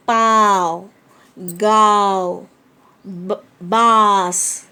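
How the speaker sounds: "पाव", "गाव", "बास" read very slowly with the vowels through the nose; nasal reading. Shown as a demonstration. Teacher reads the picture words nasally for pupils. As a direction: stretch each word and let the nose carry the vowel. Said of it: "मेरी आवाज़ अलग से निकल रही".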